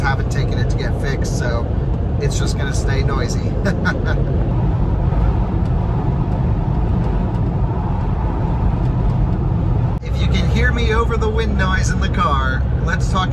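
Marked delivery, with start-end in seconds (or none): 3.5-10.0 long laugh. Real, not acted. Laughs about noisy top.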